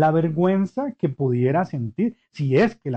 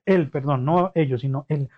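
ordinary talk, nothing marked

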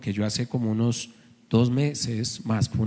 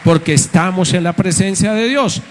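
preaching at full force; 3 semitones higher, 10 dB louder than usual. Acted. Pastor preaching loudly to his congregation. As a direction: drive it home to the church.

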